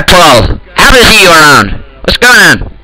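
normal talking voice

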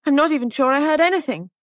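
high, rushed, loud protest; distressed protest. A narrator's reading. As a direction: blurt it out quickly, overwrought. Molly cries out under questioning.

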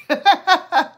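short real laugh; amused laugh. Real, not acted. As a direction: laugh at the chat. Laughs at chat comment.

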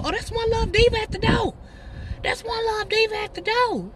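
high, sing-song self-announcing; playful announcer. Pretend. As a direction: proudly announce yourself at the door. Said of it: "talking about".

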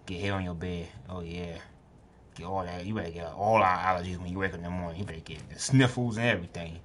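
normal talking voice

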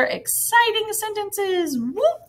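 high, excited lift into a whoop. Real, not acted. Teacher wrapping up exclamation marks for first graders, excited. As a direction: build to a high cheer.

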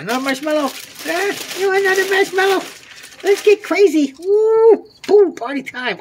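high, loud party cheer; rowdy party animal. Real, not acted. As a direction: whoop it up with the raccoons.

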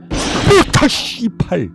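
panicking gamer. Real, not acted. Jump scare in horror game.

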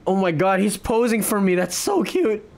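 normal talking voice

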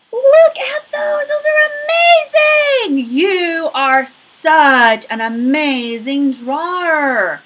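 high, loud, slow gushing praise; over-the-top delight at a child's drawing. Acted. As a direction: lay the praise on thick, stretching every word.

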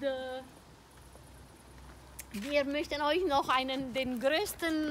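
normal talking voice

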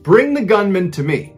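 high, loud shout to a crowd; narrated yell. A narrator's reading. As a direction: call out a forceful command over the chaos. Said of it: "yells to the crowd".

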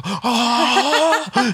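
high excited squeal; fan cam shriek. Retold or imitated. Imitating a fan caught on camera, high squeal. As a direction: squeal like a fan spotted on the big screen. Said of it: "then it goes".

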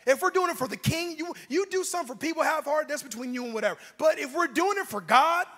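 loud, punched sermon delivery; full-force preaching. Real, not acted. Preacher urges congregation toward excellence for God, loud preaching. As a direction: drive each phrase home, building to a shout.

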